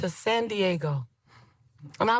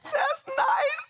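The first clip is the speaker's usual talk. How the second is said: very high, hammed-up weepy voice; mock tearful squeal. Driver moved by her friend's what-if, mock tearful. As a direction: squeak it out as if overcome, played for laughs. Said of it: "I was like".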